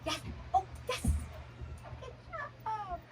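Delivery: high excited praise for a puppy; high dog praise. Real, not acted. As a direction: cheer the puppy on. Praising puppy after recall.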